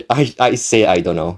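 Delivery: usual speaking voice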